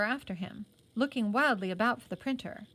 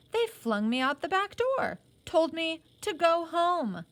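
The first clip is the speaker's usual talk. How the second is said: higher and louder, indignant but held in; suppressed anger; 8 semitones higher, 5 dB louder than usual. A narrator's reading. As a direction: let the hurt pride rise through the words. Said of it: "he was angry".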